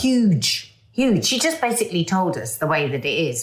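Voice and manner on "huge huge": Huge punched higher and louder; emphatic. Real, not acted. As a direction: hammer the word Huge. Emphatic repeat.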